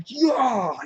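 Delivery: high, loud stabbing cry; mock attack yell. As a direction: yell out the blow as it lands. Acts out stabbing in the head.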